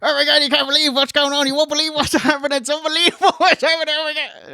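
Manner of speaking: high, fast, manic babbling; Eugene impression. Commentator voicing Eugene backstage, high manic gush. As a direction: gush it out breathless and giddy like Eugene. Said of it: "doing his best Eugene impression".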